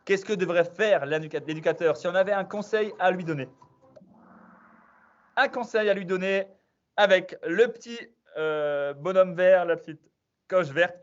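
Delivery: quiz-master hype; quiz host announcement. Acted. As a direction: hype the players as you read out the question. Quiz host announcing results and question.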